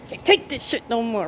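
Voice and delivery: put-on film-line delivery; movie quote. Fed-up worker quoting a film line, put-on voice. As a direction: do a half-serious movie impression. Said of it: "I forgot what movie that's from".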